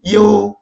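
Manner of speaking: 이유 high and held, gliding from i into yu; teacher demonstrating 이유 as one long Chinese syllable; Chinese reading. Shown as a demonstration. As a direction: model 이유 on a high, level tone. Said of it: "이렇게 읽어야 됩니다".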